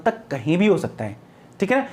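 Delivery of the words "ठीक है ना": ordinary talk, nothing marked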